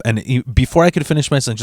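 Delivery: ordinary talk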